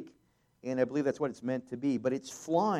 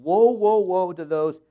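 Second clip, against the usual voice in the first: woe called out loud, then slower; preacher booming the eagle's cry; dramatic loud reading; 8 dB louder than usual. Shown as a demonstration. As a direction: proclaim the warning from a height. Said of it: "let me read this in".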